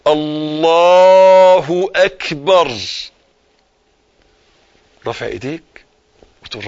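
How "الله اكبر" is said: very slow, stretched الله اكبر with long held vowels; drawn-out takbir. Shown as a demonstration. Preacher leading listeners to repeat the takbir, slow. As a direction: draw الله اكبر out slowly for them to copy. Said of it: "اسمعني".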